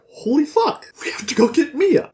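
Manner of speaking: high, urgent exclamation; mock alarm. Storyteller acting Roland learning she left, urgent mock alarm. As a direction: blurt it high, then hurry on. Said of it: "Roland's like".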